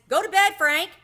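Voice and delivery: hammed-up grandma telling grandpa off; grandma scolding. A narrator's reading. Grandma Miller tells dozing grandpa to go to bed, loud scold. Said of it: "Grandma Miller says".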